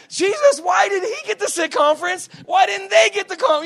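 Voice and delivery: very high, shouted complaint; mock indignant protest. Jealous believer complaining to Jesus about favourites, mock indignant shout. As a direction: whine loudly that it is unfair.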